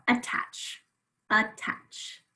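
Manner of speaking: attach said slowly as "uh-tach"; sounded out. Shown as a demonstration. Teacher has pupils hunt the schwa in attach, sounded out. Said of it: "Where is the schwa?".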